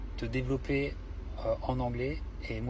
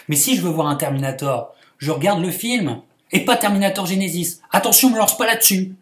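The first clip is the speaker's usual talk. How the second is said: mock rant; 5 semitones higher, 13 dB louder than usual. Rant at Vladimir.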